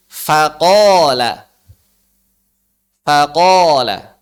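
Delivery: Faqala with thin fa, heavy qaf, thin lam; slow, loud Faqala with only qaf thick. Shown as a demonstration. Teacher models Faqala slowly for students to copy. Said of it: "Faknya tipis, qafnya tebal, lamnya tipis".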